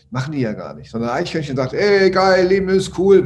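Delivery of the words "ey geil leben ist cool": higher, much louder and overjoyed; excited squirrel. Acted. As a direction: burst out like a carefree squirrel. Carefree squirrel in his fable. (begeistertes Eichhörnchen, höher und viel lauter)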